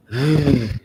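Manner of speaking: short heavy sigh; stressed sigh. Real, not acted. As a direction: breathe out in low frustration. Stressed while searching her email.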